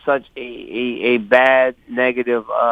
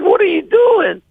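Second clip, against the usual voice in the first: very high, slowed, drawn-out question; squeaky, exaggerated retelling of himself; high-pitched retold exclamation; 4 dB louder than usual. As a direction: stretch it out way up high, in mock alarm. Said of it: "in this, in this voice".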